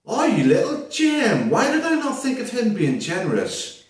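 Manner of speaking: high, excited gushing; thrilled and touched; delighted. Real, not acted. Delighted at donor's name.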